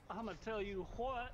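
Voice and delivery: a jokey Mexican-Canadian twang on it; Mexican Canadian accent. Pretend. Friends laughing, he tries a Mexican Canadian accent. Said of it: "I sounded like Mexican Canadian".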